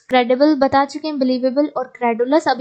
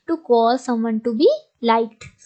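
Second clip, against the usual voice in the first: slow dictation; much slower than usual. Shown as a demonstration. Teacher dictating a definition.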